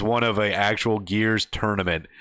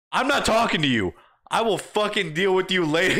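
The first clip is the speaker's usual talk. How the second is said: higher, dismissive threat; mock threat; 6 semitones higher than usual. Gamer brushing off the girlfriend, mock threat. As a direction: wave her off with a threat.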